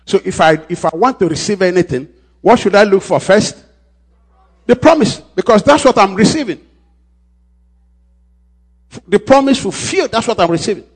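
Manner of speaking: spaced out sermon emphasis; preaching at full force. Real, not acted. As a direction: drive it home to the church.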